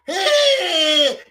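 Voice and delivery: loud, high, drawn-out cry; hammed-up "heey"; imitated woman's yell. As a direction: mimic her long shrill-pitched holler. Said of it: "he had the woman go".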